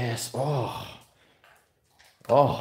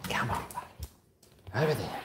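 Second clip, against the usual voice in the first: whispering; 7 dB quieter than usual. Real, not acted. Whispered coaxing of the dog.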